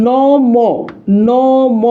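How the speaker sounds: "নম" very slow, both vowels held; long sustained syllables in "নম"; pronunciation demo. Shown as a demonstration. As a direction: stretch "নম" for the class to copy. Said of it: "উচ্চারণ করেন তো আমাদের সাথে".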